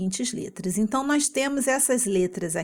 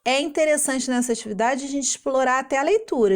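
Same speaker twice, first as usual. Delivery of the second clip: usual speaking voice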